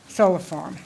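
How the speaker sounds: normal talking voice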